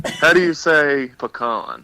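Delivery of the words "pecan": pecan said "puh-KAHN"; pecan pronunciation. Shown as a demonstration. Caller asks how he says it, pecan as puh-KAHN. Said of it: "you want me to say pecan or pickin'".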